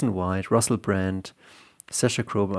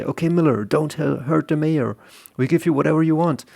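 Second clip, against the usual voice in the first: higher, hurried pleading to the gunman; nervous negotiator, pitched up and quick; hostage negotiator; 6 semitones higher, 3 dB louder than usual. Acted. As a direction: talk him down fast and anxious. Police negotiating in hostage scene.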